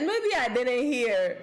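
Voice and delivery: slow words through laughter; laughing disbelief. Real, not acted. Laughing incredulous reply.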